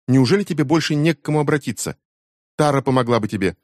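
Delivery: a daughter snapping at her mother; indignant teen. A narrator's reading. Becky scolds her mother. (возмущённая дочь, высоко и громко)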